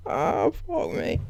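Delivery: usual speaking voice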